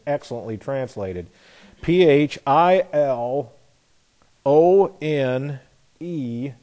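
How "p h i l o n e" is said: slow, letter-by-letter spelling; deliberate, separated letters; spelling out slowly. Real, not acted. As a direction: spell it out with space between letters. Spelling out a Greek word.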